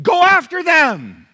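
high, slow, shouted command; rally the church to go; exhortation. Real, not acted. Preacher shouting at his church to reach people.

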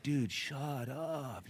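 slow, drawn-out muttered exasperation; silent inner plea. Retold or imitated. Listener silently begging a motor mouth to stop, drawn-out mutter. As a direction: say it under your breath, stretching it out. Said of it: "in your heart, you're like".

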